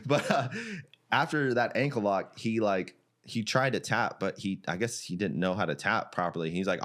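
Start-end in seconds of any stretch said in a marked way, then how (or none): none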